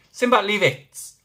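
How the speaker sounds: loud, high, slow order; stern command. Real, not acted. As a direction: tell the dog to drop it. Telling the dog off.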